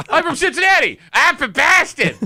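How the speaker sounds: put-on high, loud, laughing parody of a Boston accent; mock the lazy Boston-guy bit; parodying the cohost's bit as a lazy Boston guy. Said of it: "showing up as guy from that city".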